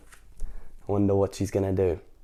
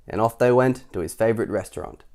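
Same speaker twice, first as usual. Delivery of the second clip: ordinary delivery, nothing marked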